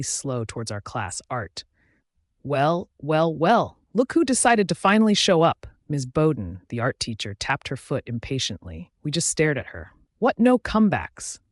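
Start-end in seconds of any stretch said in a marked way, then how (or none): 2.4-5.7 loud, high sarcastic welcome; mocking teacher. A narrator's reading. Art teacher to late students, mocking. 10.2-11.4 high, loud smug taunt; smug smirk. A narrator's reading. Teacher taunting silent students, smug. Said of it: "she asked with a victory smirk".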